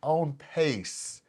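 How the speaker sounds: loud, emphatic hype for the guest; podcast host intro. Real, not acted. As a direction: sell the big numbers to listeners. Host pitches guest's success to audience.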